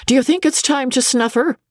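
high-pitched midwife's question, rising at the end; character voice for a midwife consulting the doctor; fat midwife. Acted. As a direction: pipe up high as an old-hand midwife.

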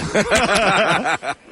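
real laugh, high and bursting; burst of laughter. Real, not acted. Comedian laughing at his own Broncos story, real laugh. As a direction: let the laugh spill out.

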